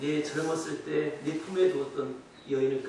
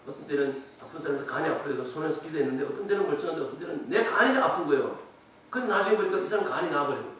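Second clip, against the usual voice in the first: ordinary delivery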